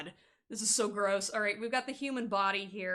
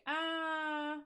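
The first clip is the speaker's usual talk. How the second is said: higher, whiny fake-over-it voice; mock a pining ex, pitched up; 6 semitones higher than usual. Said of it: "Not the fake".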